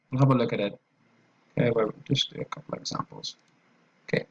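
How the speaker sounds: ordinary talk, nothing marked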